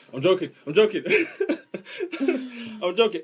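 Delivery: loud laughing protest. Real, not acted. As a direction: laugh it off loudly.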